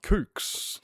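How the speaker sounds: "kooks" breathed out high and voiceless; whispered kooks. Shown as a demonstration. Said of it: "Some people say".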